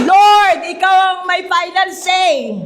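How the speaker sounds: loud proclamation to the Lord; a believer shouting his trust to God; declaring faith. Pretend. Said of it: "Ka maniniwala".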